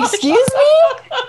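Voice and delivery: high, loud, drawn-out disbelief with laughter; incredulous. Retold or imitated. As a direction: stretch it out in laughing, indignant shock. Retold shock at husband's misreading.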